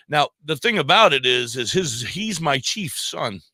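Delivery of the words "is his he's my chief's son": ordinary talk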